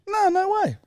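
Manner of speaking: squeaky-high protest; mock denial. Host accused by co-host, high mock denial.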